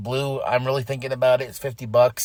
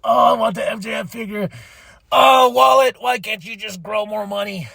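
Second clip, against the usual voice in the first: mock whining shopper; 5 semitones higher than usual. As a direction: beg your wallet like a greedy fan. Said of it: "and I'm like".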